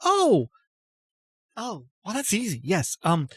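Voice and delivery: high-pitched sudden oh; a lightbulb moment of relief; startled realization. Acted. Apatia suddenly relieved, high-pitched exclamation.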